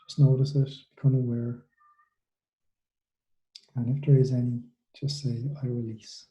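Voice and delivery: soothing meditation guide, unhurried and hushed; guided meditation. Acted. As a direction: ease them gently through the body scan. Leads a guided body-scan meditation.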